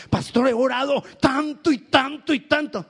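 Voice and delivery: high, insistent acted grievance; mock complaint. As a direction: imitate a worshipper protesting to the pastor. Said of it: "Él no escucha nuestra queja".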